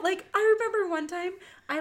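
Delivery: normal talking voice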